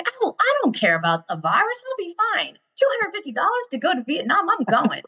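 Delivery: high-pitched, breezy brush-off; imitated carefree son. Son dismissing mom's virus warning, imitated carefree insistence. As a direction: mimic a son waving away worry, voice way up.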